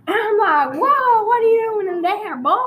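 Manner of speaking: mock-alarmed country Texan voice; put-on Texan accent. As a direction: challenge an intruder in a hammed-up Texas accent. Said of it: "I'm gonna change accents for a while".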